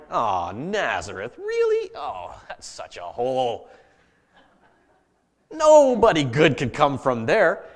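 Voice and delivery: exaggerated grumbling, slow and drawn-out; hammed-up complainer moaning about the place; griping. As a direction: play up a slow, scornful gripe. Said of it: "He starts griping already".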